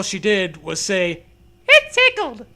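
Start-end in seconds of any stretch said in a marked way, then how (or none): 1.7-2.4 put-on very high, squeaky little-girl voice; high squeaky girlish line; Dee Dee voice. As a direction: chirp it like a silly kid. Voicing Dee Dee giggly line.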